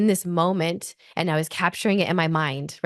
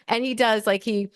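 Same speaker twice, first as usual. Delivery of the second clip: normal talking voice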